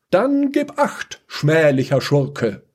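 slow, very high, grandiose threat; solemn heroic warning. Acted. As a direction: declaim like a hero.

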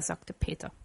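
ordinary delivery, nothing marked

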